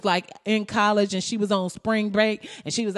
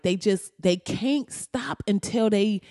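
normal talking voice

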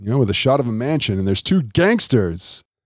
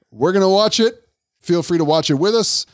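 bright, high sign-off pitch; excited host; 9 semitones higher than usual. Real, not acted. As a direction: sell the break with energy. Host signing off for break.

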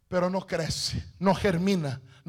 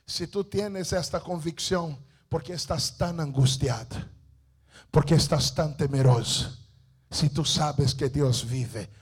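quietly reassuring his wife, lower and softer; a calm husband's gentle question, well below the preaching voice; husband gently calms his frightened wife, low and soft; 8 semitones lower, 6 dB quieter than usual. Acted. Husband's gentle reply in story.

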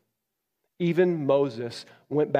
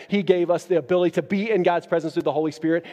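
ordinary talk, nothing marked

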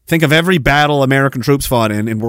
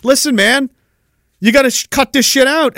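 exasperated Russia; 10 semitones higher than usual. Retold or imitated. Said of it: "they're like".

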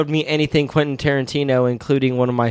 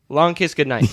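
usual speaking voice

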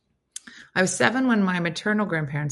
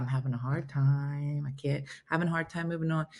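softer imitated confession; understated, low-volume retold confiding; confiding hardship; 3 semitones lower, 6 dB quieter than usual. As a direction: admit it quietly, as if to family. Imagined grandmother confiding grief.

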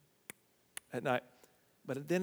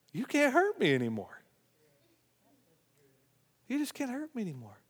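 calm grandmother; 10 semitones higher, faster than usual. Retold or imitated. Grandmother answers her husband calmly.

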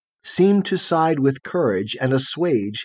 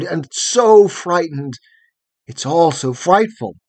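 louder, higher, anguished outcry; shuddering cry of shame; 4 semitones higher, 5 dB louder than usual. A narrator's reading. Shamed boy cries out to the girl about his fear, anguished and loud. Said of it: "he shuddered and cried".

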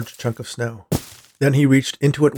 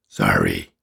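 much lower, gravelly and sheepish; a low rumbling sorry; deep snowman apology. Acted. Snowman apologises to the boy, low gravelly rumble.

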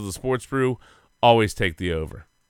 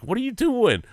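mock coach yell; 12 semitones higher than usual. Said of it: "Tom Coughlin yelling at the punter".

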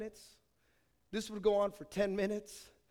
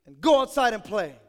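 loud parental order, higher; parents scolding; 4 semitones higher, 12 dB louder than usual. Retold or imitated. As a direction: bark the parents' order to go outside. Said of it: "they would say".

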